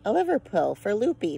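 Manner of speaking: usual speaking voice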